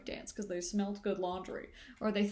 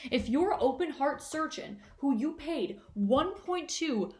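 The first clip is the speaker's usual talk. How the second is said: ordinary talk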